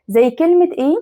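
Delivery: normal talking voice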